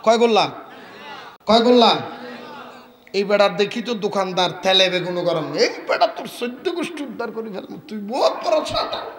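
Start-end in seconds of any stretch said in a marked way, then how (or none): 1.5-2.0 louder call to the crowd. Real, not acted. Preacher pressing the silent crowd, louder question. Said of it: "কথা বলে না ভাই". 5.5-9.1 higher, rapid stream of insults; abusive scolding. Acted. Shopkeeper cursing the seller in front of people, angry abuse. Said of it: "এমনভাবে গালিগালাজ করতেছে অকথ্য ভাষায়".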